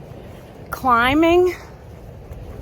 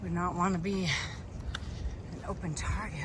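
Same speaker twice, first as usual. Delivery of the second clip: whispering; 6 semitones lower, 13 dB quieter, faster than usual. Real, not acted. Wary of coyotes, hushed aside.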